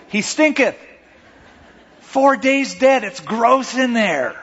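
high, slow, laughing disgust; gross-out Martha. Retold or imitated. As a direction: savour the ick, drawn out. Repeating Martha's protest with relish.

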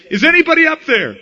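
high, loud, fast panicked call upward; desperate shout to heaven for rescue; desperate cry. Retold or imitated. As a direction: yell for help, dangling off a cliff. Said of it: "And in desperation".

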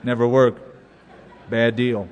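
low-pitched, dismissive naysayer; pessimist. Acted. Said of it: "I heard about two pessimists".

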